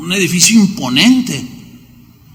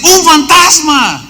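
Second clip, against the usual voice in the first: frightened cry; 9 semitones higher, slower than usual. Acted. Disciples shouting in terror at the ghost on the water, frightened cry. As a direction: shout it like you've seen a ghost.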